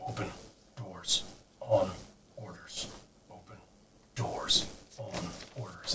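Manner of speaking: low, soft, slow ghostly guard repeating by rote; low guard drone. Acted. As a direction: drone the order like a spent ghost soldier. Guard ghost repeats his order.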